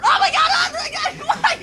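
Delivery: high loud laughing mock cry; laughing panic. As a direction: shriek and laugh at the drop. Rider on the drop.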